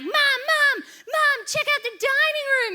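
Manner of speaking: put-on high, loud kids calling for Mom; excited little boys. Little boys alert mother to the flooded dining room, excited high yell. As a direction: imitate kids urgently hollering.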